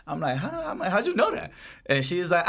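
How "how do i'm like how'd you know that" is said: high-pitched, amused disbelief; surprised question. Retold or imitated. Driver to passenger who guessed his background, surprised. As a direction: retell being caught off guard, pitch jumping up. Startled by her correct guess.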